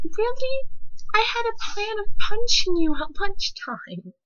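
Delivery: high, loud incredulous threat; joking about punching a bully at lunch; mock-menacing outburst. Real, not acted. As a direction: squeal the threat in mock outrage. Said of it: "comic threat".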